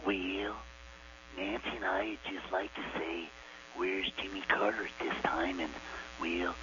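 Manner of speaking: soft, hushed put-on Reagan voice; Reagan impression. Caller does Reagan for the host, softly. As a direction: murmur it like an aging president. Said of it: "Okay, here we go".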